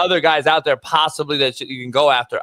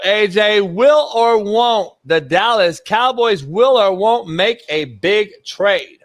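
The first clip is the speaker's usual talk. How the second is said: will or won't drawn out; slow, teasing game show ask; slow host question; 4 semitones higher, slower than usual. Acted. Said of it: "game host asking".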